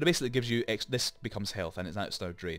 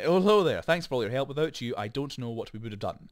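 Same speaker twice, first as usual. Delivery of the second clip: louder, higher read of the farmer's lines; a hint of a cheerful farm lady; lightly voiced farmer lady; 4 semitones higher, 6 dB louder than usual. Shown as a demonstration. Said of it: "I'll just read it".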